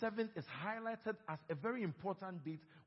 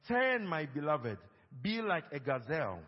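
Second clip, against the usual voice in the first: loud, slow Bible reading; scripture reading; 8 dB louder, slower than usual. Acted. Pastor leads the church reading Song of Solomon, loud and slow. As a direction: read the verses aloud for all to follow. Reads scripture aloud together.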